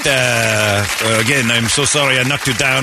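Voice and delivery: mock French accent; hammed-up French athlete; Victor Wembanyama. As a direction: apologize formally in a thick French accent. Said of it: "My name is Victor Wembanyama".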